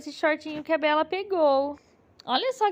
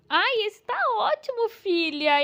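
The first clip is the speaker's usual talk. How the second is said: ordinary talk, nothing marked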